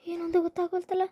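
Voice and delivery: soft, fretful near-whimper; hushed worried lament to herself; anxious muttering. Acted. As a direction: mutter it quietly, close to tears. Mother puzzled about missing daughter.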